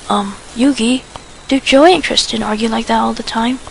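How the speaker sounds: plain reading voice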